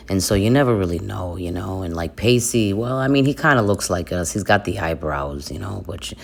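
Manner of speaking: low, quick Jersey Italian patter; New Jersey Italian accent. Acted. Explaining the big Italian family, quick Jersey accent. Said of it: "I got my freaking accent back".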